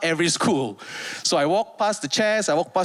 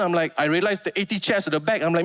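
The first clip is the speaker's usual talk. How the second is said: normal talking voice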